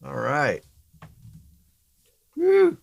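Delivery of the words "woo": high whooping cheer; excited woo. Real, not acted.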